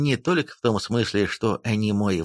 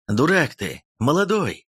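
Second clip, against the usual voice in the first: usual speaking voice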